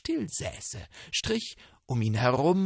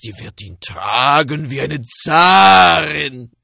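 put-on old man voice; 12 dB louder than usual. Old man delighted with his find.

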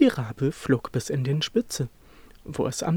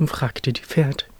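ordinary delivery

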